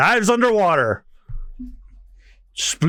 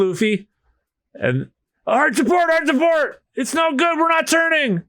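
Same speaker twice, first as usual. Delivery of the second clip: panicked crew shouting; 10 semitones higher than usual. Acted. Crew steering away from whale.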